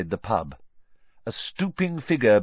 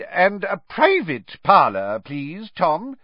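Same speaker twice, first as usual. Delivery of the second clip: a bit higher and louder, pointed request; pointedly; 8 semitones higher, 5 dB louder, slower than usual. Acted. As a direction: say it with meaning to get rid of them. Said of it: "said Fudge pointedly".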